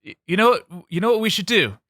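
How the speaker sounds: high, loud buddy call; lightly voiced party bro; mock bro greeting. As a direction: hail your old pal. Plays Holly King.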